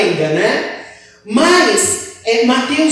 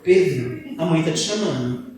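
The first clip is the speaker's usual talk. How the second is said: speaking softly; 7 semitones lower, 6 dB quieter than usual. Shown as a demonstration. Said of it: "Falar baixinho".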